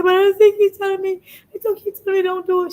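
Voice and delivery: loud, high mock wail; imitated whining protest. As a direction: protest in an exaggerated high whine. Retold tearful protest to mentor.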